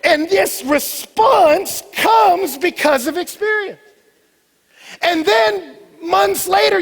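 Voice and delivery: loud, high-pitched pulpit preaching; full-force preaching. Acted. Preacher urging church to go taste, loud preaching. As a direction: drive it home to the whole congregation. Preaches snow cone story loudly.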